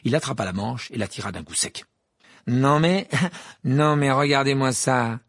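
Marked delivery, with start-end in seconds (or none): 2.5-5.2 Malfoy, gloating and drawn out, pitched up; a louder, slow, mocking gloat; delighted sneer. A narrator's reading. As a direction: crow over the shabby robe with glee. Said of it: "d'un ton extasié".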